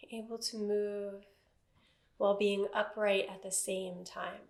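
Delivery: calm, even meditation voice with long silences; guided meditation. Acted. Teacher settling a seated class into body awareness, guided meditation. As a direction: guide them gently into stillness, phrase by phrase.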